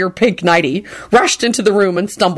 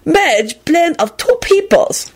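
knowing; 10 semitones higher, slower than usual. Acted. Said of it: "pronounced knowingly".

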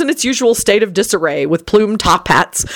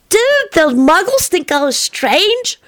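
put-on slow high child voice, frowning; frowning boy; 11 semitones higher, slower than usual. A narrator's reading. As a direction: ask it puzzled. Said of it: "Teddy frowned".

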